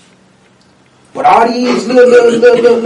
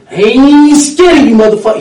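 Bernie's defiant shout; 6 semitones higher, 10 dB louder than usual. Retold or imitated. Mimicking Bernie yelling at the crowd, loud defiance. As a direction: shout it back like you mean it. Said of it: "what he'll tell you too".

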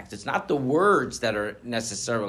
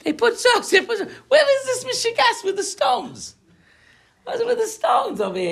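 much higher, louder, laughing puzzlement; mock bewildered asker; 8 dB louder than usual. Rabbi voicing a confused cemetery visitor, high laughing bewilderment. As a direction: play a baffled visitor squinting at the stones.

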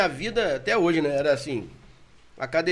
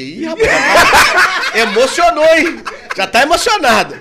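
slow, high, loud laughing teasing; laughing tease; 9 semitones higher, 12 dB louder, slower than usual. Retold or imitated. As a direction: tease him while cracking up. Teasing the overcome driver.

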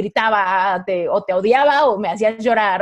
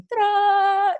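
lightning crack sound; 11 semitones higher than usual. Retold or imitated. Imitated lightning strike.

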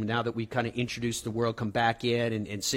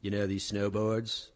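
frat bro; 4 semitones lower, 9 dB quieter than usual. Retold or imitated. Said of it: "are like".

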